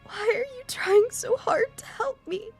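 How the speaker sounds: high, slow, tearful plea; tearful question. Acted. Emmy crying as she questions Hisao, tearful. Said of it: "Tears run down her face".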